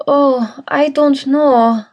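put-on high, slow, hesitant French-accented reply; mildly alarmed. A narrator's reading. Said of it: "sounding alarmed".

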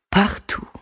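breathless whisper of desire. Acted. Countess whispering to Fanny in admiration. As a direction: breathe the word out, voiceless.

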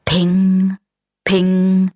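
high ringing ping sound; ping noise. Acted. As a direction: chime it like a metal ping. Same noise again. (helles Ping-Geräusch)

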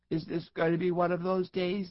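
lightly voiced small daughter, high and earnest; a child asking her dad gravely; a hint of a solemn young girl; serious little girl. A narrator's reading. Little daughter asks her father.

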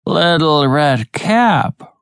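a wolf pretending to be a child; wolf faking the girl. A narrator's reading.

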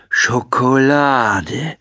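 long drawn-out, craving call; gleeful call. A narrator's reading. Sweet-toothed animals call out their favourite treat, gleeful drawn-out call. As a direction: stretch it out with a rising, greedy lilt. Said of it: "riefen da die Leckermäuler".

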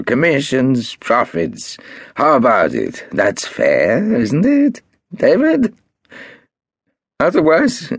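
Marcus, wheedling. A narrator's reading. Marcus coaxing Golda to negotiate together, wheedling. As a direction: sweet-talk him into a deal.

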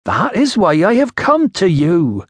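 put-on high, slow, emphatic Iceni-accented voice; foreign Iceni accent. A narrator's reading. Woman explaining why she came to the official, Iceni accent. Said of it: "trouble following your accent".

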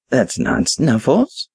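high, slow, squeaky voice cracking; an old lady's voice cracking in dismay. A narrator's reading. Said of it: "she exclaimed, her voice cracking and squeaking".

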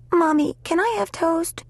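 put-on very high small-child voice; little girl's voice. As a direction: ask Mommy like a young daughter. Young daughter calls her mother.

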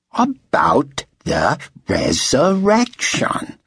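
drawn-out, word by word, high; slow and condescending. A narrator's reading. Motor pool man explaining to the director, slowly condescending. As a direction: spell it out as if to a dimwit. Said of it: "spoke slowly, condescendingly".

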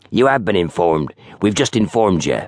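lightly voiced cantankerous old man; a testy old porter, mildly snapping; snappish old porter. A narrator's reading. Old porter insists the boss was told, snappish. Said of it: "snapped the second porter".

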